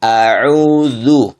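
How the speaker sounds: a'udzu dengan a tipis; hamzah tipis. Shown as a demonstration. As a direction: ucapkan a pada a'udzu tipis, bukan o. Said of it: "Saya contohkan". (a'udzu dengan a tipis)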